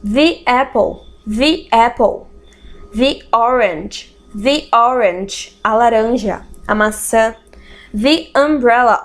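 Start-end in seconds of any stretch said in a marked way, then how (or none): 0.0-5.6 "the" said as thee before vowels; slow thee apple, thee orange. Shown as a demonstration. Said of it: "usar a pronúncia the antes de palavras que têm som de vogal". 7.9-9.0 "the" as thee before umbrella. Shown as a demonstration. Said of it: "olha a diferença".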